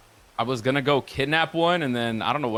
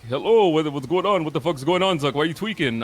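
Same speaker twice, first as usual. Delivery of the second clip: higher, louder, rattled questions; agitated friend; 4 semitones higher, 4 dB louder than usual. Retold or imitated. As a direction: fire off the questions like he's panicking. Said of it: "He's like".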